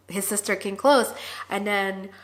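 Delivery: ordinary talk, nothing marked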